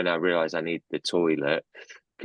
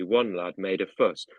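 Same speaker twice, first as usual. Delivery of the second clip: usual speaking voice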